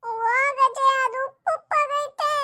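put-on very high, drawn-out child whine; whining child. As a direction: wail in protest to grandmother. Child whining about salty drink. (ರಾಗ ಎಳೆದು ಗೊಣಗುವ ಮಗುವಿನ ಧ್ವನಿ)

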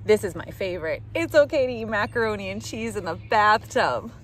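usual speaking voice

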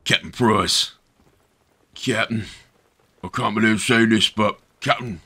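put-on low, slack British chav accent; mock British chav. Soldier addressing Captain Price, mock British chav accent. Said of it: "anyone who talks like this".

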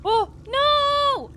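high, drawn-out wail of "no"; dismayed cry. Real, not acted. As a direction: cry out loudly as it goes wrong. Dismayed at game mishap.

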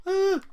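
loud, high shout; sudden high battle yell. Acted. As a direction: charge in yelling. Battle cry in game.

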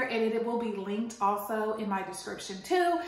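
usual speaking voice

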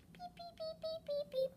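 high chanted beep-beep dialing noise; phone dial beeps. Pretend. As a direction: imitate a phone ringing out, high. Phone call dialing sound.

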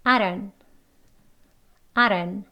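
brief "I don't" as "ai-ron"; short isolated "I don't", flapped; short reduced form. Shown as a demonstration. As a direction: clip DON'T short with a tapped d and no t. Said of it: "나누어서 먼저 짧게".

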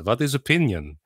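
put-on joke-telling Russian accent. Russian answering a pollster in a joke, puzzled Russian accent. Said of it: "the Russian guy says".

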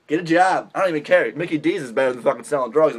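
usual speaking voice

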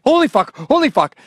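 high, loud startled cursing; re-enacted panic. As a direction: blurt it out as if just after a crash. Reliving shock after hitting deer.

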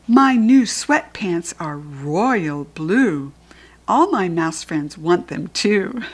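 slow sing-song rhyme read aloud; picture-book storytime voice; reading to a child. Acted. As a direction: read the rhyme slowly to a little listener. Reads the book's rhyme aloud.

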